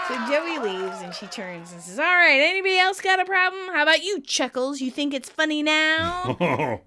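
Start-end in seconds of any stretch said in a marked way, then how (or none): 2.0-6.8 angry challenge. Retold or imitated. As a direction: dare them to laugh, loud. Monica challenging the staff.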